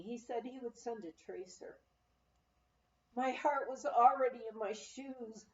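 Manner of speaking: plain reading voice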